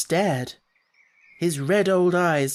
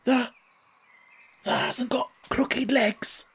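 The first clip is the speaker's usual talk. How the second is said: quavering hoarse; 8 semitones higher, slower than usual. Acted. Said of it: "quavered Ben more hoarsely yet".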